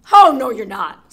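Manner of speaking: huge troll roar. Acted. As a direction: bellow like an angry troll. Troll shouts at goat.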